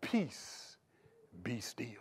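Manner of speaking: soft whispered "Peace, be still"; calm the storm in a whisper; hushed command. Retold or imitated. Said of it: "he said this".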